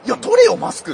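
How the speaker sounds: loud, high mock astonishment; hammed-up incredulous retort; retold disbelief. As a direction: exclaim in disbelief, then demand it loudly. Retold disbelief on meeting old peer.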